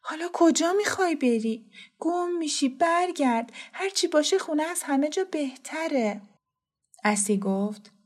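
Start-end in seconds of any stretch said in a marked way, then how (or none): 0.0-6.2 put-on high-pitched, alarmed child character; terrified. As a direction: plead anxiously to turn back, voice pitched high. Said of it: "ببی وحشت زده گفت". (با وحشت و صدای زیر)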